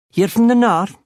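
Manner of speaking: high, lilting County Cork question; Cork accent. A narrator's reading. Barman asks if he's from the north, Cork accent. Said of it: "except in that Cork accent, it was like".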